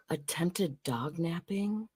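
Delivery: gasped, slow whispered disbelief; shocked whisper. Real, not acted. Host reacting to dog napping news with a shocked whisper. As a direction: breathe it out in mock horror.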